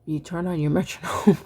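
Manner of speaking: breaking into a laugh mid-sentence. Real, not acted. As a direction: chuckle through the words. Laughs at own slip.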